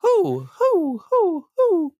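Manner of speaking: high hoots of who, like owls; mock crowd hooting who as she descends. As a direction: hoot who, who like an owl. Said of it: "people are just like".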